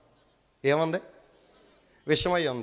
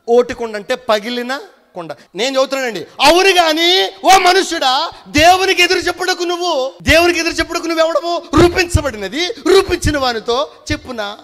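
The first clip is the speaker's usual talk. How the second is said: loud, high, ringing sermon voice, much higher and louder; preaching at full force; 13 semitones higher, 12 dB louder than usual. Acted. Preacher booming a Bible verse to the congregation, full-force preaching. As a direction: proclaim the verse to the whole church at full volume. Preacher reading scripture loudly.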